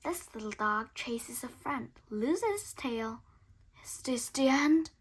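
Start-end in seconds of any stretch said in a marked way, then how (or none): none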